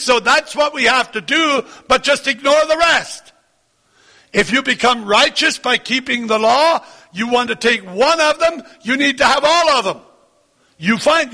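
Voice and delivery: high-pitched, insistent sermon delivery; emphatic preaching. Real, not acted. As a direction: press the point home to the congregation. Preaches insistently on keeping the law.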